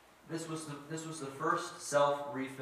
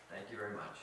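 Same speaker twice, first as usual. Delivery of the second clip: much softer and lower, as an aside; understated polite reply; 6 semitones lower, 8 dB quieter, faster than usual. Retold or imitated. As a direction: drop the voice for a deadpan retold thanks. Dad's dry reply to mafia.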